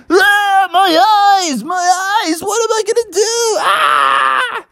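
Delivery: high, hammed-up agonized wailing; screaming in pain. Crowd gets pepper spray in eyes, screaming. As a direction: wail as if the eyes burn.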